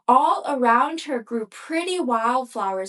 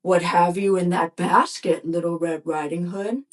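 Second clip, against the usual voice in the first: gruff wolf voice; 4 semitones lower than usual. A narrator's reading. Wolf questions the girl.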